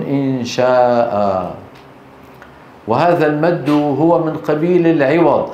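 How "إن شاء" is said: إن شاء with the long alif held slowly; slow madd. Shown as a demonstration. Teacher showing the pausal madd slowly. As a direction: draw out إن شاء. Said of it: "يقفون".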